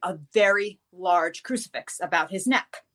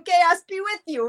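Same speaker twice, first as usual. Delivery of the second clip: high, louder imitation of a cop; amused cop impression; 10 semitones higher, 5 dB louder than usual. Cop teasing with the storyteller's blessing, high and amused. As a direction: echo it high like a tickled cop.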